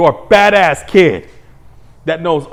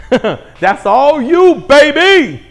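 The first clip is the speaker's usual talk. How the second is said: laughing taunt; 8 semitones higher, 4 dB louder, much slower than usual. Real, not acted.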